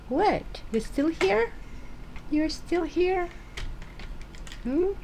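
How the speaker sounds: high playful surprise to the cat; mock surprise. Real, not acted.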